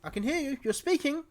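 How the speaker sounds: high, loud excited call. Acted. Robin calling back to the hidden speakers, loud. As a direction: shout back through the bars.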